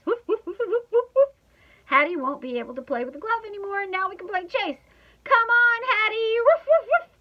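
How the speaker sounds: high, loud, gleeful dog; bubbling over, woofs and yelps; excited barking. A narrator's reading. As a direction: bark an eager invitation to play. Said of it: "I bark".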